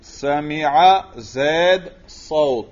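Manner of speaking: very slow, drawn-out words; each word stretched and set apart; slow dictation. Shown as a demonstration. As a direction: dictate word by word for students writing. Dictating a sentence to write.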